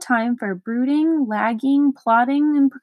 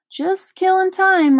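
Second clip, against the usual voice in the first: apologetic; 8 semitones higher, 4 dB louder than usual. A narrator's reading. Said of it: "replied Milo apologetically".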